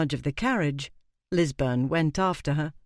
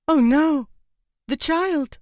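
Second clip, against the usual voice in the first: high, loud cry of alarm; 11 semitones higher, 6 dB louder, slower than usual. A narrator's reading. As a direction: cry out in sudden fear for the child. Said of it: "and Leone cried".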